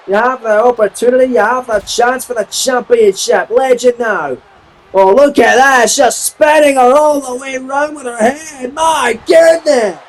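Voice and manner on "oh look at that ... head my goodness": high, stretched cry of disbelief; astonished shout. Real, not acted. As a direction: sell the move like you can't believe it. Astonished at the spinning move.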